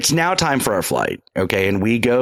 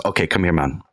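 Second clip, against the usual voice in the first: lightly imitated Jamaican accent, pitched low; low, casual mock Jamaican; Jamaican man; 6 semitones lower than usual. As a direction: play the Jamaican guy in a lower voice. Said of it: "he goes".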